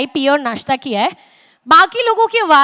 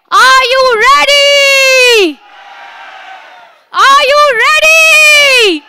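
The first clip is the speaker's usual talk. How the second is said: much higher, louder, slow drawn-out yell; hype shout; 11 semitones higher, 7 dB louder, much slower than usual. Acted. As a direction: call out to the whole hall, full energy. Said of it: "louder with all of your energy".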